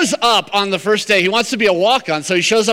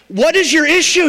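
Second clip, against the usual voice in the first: exasperated coach; 9 semitones higher, 4 dB louder than usual. Retold or imitated. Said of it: "he goes".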